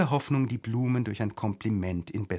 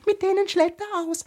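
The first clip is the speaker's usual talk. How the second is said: very high, loud squeaky flower; piping little daisy voice; daisy calling out; 5 dB louder than usual. A narrator's reading. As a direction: shriek it like a tiny flower. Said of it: "rief ein Gänseblümchen".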